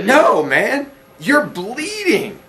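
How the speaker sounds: high, alarmed friend voice; Trevor voice. Acted. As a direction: draw it out high, insisting in alarm. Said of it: "I'm gonna kick in with the Trevor voice".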